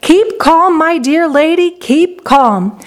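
crying out. A narrator's reading. Factory owner soothes frantic mother, crying out. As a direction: reassure her emphatically, settling toward normal pitch. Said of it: "cried Mister Wonka".

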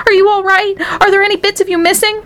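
high-pitched fretting mother; acted maternal panic; anxious cry. A narrator's reading. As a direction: question the child urgently, pitch way up. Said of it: "cried Missus T V".

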